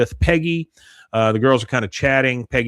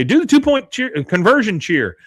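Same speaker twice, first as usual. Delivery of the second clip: calling out; 9 semitones higher than usual. Retold or imitated. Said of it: "you hear Peggy say".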